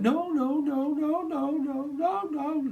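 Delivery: very high yelped no-no-no; squealing animal. Pretend. Animal spirit in the reading squealing no, very high. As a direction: yap like a panicking creature.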